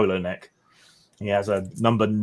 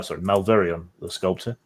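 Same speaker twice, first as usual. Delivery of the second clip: ordinary delivery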